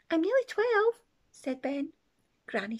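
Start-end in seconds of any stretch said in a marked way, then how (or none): 0.1-1.0 put-on child voice. A narrator's reading. Ben correcting Granny.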